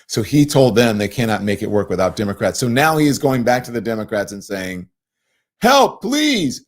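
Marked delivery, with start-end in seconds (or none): 5.6-6.6 high, loud mock plea; pleading for help, hammed up. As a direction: beg like a desperate party. Republicans begging Democrats for help.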